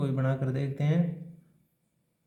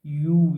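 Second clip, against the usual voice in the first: यू said loudly and sharply; emphatic letter name; 8 dB louder than usual. Shown as a demonstration. Teacher calling out यू to the class. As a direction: punch out यू. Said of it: "teacher stresses the letter sound".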